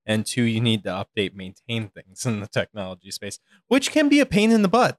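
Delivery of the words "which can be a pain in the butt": high, loud mock complaint; exaggerated exasperation. Real, not acted. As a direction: whine it out for comic effect.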